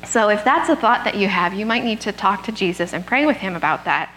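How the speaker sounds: ordinary talk, nothing marked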